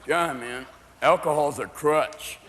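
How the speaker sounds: low, slow, soft stoner; stoner friend. Acted. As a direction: drag it out low like a stoned buddy. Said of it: "your friends who smoke marijuana going".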